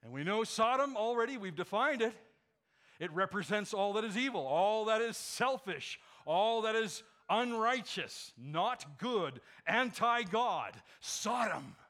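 loud, rising preacher's build; full-force preaching. Acted. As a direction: hammer each line home. Preaching crescendo.

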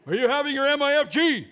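loud mock call from the next stall. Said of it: "suddenly from another stall somewhere".

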